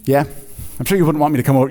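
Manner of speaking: usual speaking voice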